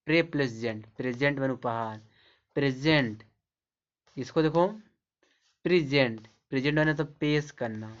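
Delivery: ordinary talk, nothing marked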